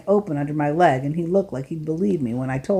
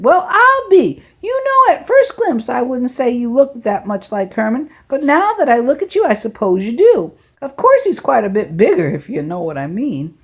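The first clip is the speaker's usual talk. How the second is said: put-on the driver marveling at the boy, higher and louder; amazed older man; 6 semitones higher, 6 dB louder than usual. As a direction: ham up the driver's delight. Lefty's amazed reply.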